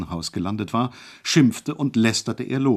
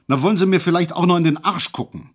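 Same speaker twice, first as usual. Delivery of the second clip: higher, louder furious roar; bellowed; 7 semitones higher, 5 dB louder, faster than usual. A narrator's reading. Hejula roaring at the doctor with the flashlight. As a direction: explode at the doctor. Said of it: "und brüllte".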